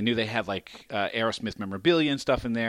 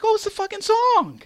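surprised; 6 dB louder than usual. Retold or imitated. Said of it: "I'm like".

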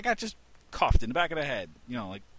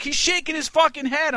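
much higher, louder indignant cry; outraged protest; 8 dB louder than usual. Retold or imitated. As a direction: insist hotly he's defying you. Boss insists, outraged.

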